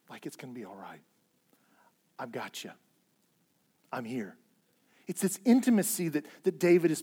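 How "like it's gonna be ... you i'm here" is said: soft, hushed comforting voice of God; tender reassurance. Acted. God soothing David, soft and tender reassurance. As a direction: speak it like a hand on the shoulder.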